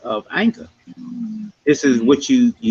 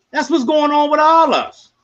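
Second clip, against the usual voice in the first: pitched way up, pleading; high emphatic cry; 12 semitones higher, 3 dB louder than usual. Real, not acted. Host to the panel, cries out high in appeal.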